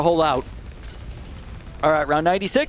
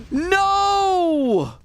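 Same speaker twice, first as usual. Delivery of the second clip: startled shout; 12 semitones higher than usual. Real, not acted. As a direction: yell no as the round goes wrong. Game suddenly breaks, panic.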